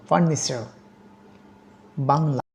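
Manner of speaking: usual speaking voice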